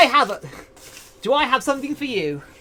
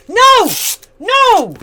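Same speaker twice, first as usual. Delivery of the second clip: mock outrage; 9 semitones higher, 7 dB louder than usual.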